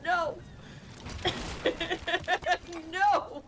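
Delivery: very high, mock-despairing wail; mock dismay. Gamer realising there is no dragon, mock dismay. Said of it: "And I was like".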